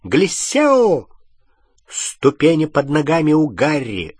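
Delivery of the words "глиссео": high, drawn-out incantation cry; exclaimed spell. A narrator's reading. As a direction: cast it urgently with a raised pitch. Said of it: "Гермиона воскликнула". (высокий возглас заклинания)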